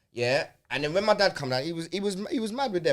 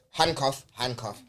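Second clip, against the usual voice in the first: normal talking voice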